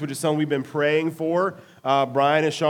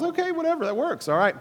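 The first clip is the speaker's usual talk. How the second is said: much higher, shrugging it off; airy whatever, pitched up high; high dismissive shrug; 13 semitones higher than usual. Retold or imitated. Retold shrug at the Legos.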